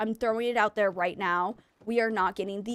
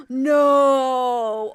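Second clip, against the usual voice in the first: much louder drawn-out horrified no; real shocked no; 9 dB louder than usual. Real, not acted. Reacting to a chat story about a creepy Furby, loud horrified no. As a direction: recoil out loud in disbelief.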